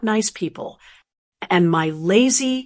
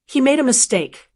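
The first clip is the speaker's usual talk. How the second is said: ordinary delivery, nothing marked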